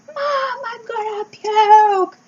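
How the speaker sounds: high, slow, mock-crying child's yell; screaming child. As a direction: wail it like a sick kid calling for mom. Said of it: "You were screaming".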